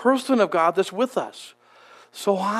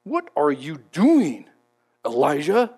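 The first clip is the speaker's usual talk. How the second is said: corrective voice; 5 semitones lower than usual. Shown as a demonstration. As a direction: spell it out like a stern reprimand. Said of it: "was that a corrective voice?".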